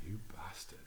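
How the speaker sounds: hushed, breathy mock curse; playful whispered "you bastard" to a friend. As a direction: hiss it as a playful insult. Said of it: "He's like".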